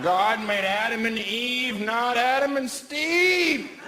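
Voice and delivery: slow, high, sing-song sermon line; a hint of a hammed-up street preacher; mock preacher. Comic mocking a preacher, slow and sing-song. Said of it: "pretending to be a right-wing redneck".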